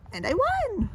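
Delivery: high bright victory call; a child proud of winning; gleeful win. Acted. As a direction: crow it out again. Child brags winning again.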